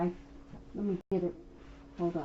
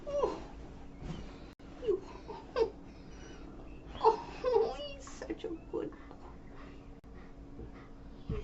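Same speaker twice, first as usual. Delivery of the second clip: talking to a dog. Real, not acted. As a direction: gush over the pup, up high. Doting on the dog.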